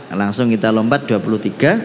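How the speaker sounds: ordinary talk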